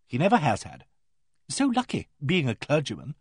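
lively, artless frankness with high swoops; frank vicar's wife. Acted. Vicar's wife recounting silly letters about her husband, naturally and frankly. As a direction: chatter candidly, quite unembarrassed.

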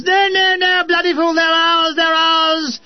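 officer desperately calling off the gunners; frantic major. A narrator's reading. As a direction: cry out in exasperated panic. Major frantic at friendly fire.

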